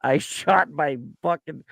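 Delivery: a hint of a Kansas drawl on a 911 call; lightly put-on Midwestern caller; faint Kansas accent impression; mock Kansas accent. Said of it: "that's not how they sound in Kansas".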